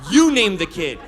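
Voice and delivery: loud, fed-up retort, starting high; acted-out exasperated outburst; pissed off. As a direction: throw the decision back at them angrily. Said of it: "So I get pissed".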